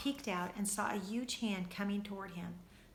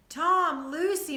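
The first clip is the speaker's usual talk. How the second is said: high, very loud call of names; 9 semitones higher, 10 dB louder, slower than usual. A narrator's reading. Father calling his children at the fridge, loud. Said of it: "Mister Little called".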